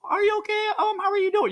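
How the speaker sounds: lightly mocking high-pitched lady voice; high voice impression. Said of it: "they speak in a much higher voice".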